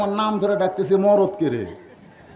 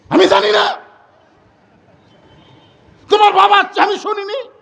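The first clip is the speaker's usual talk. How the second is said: much higher, loud scolding wife; shrill angry wife; 12 semitones higher, 5 dB louder than usual. Acted. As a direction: snap at the husband in a high voice. Angry wife's shrill reply. (রাগী বউয়ের উঁচু গলায় ঝাঁঝালো ধমক)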